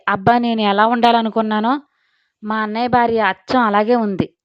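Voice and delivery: ordinary delivery, nothing marked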